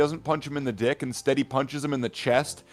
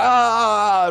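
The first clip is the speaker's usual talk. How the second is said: high loud hammed-up scream; mock dying scream; 9 semitones higher, 8 dB louder than usual. Zapped villain dies with a loud hammed-up scream. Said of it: "And then the guy's like".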